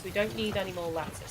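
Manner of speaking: ordinary talk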